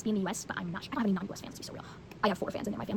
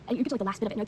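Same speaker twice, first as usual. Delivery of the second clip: ordinary delivery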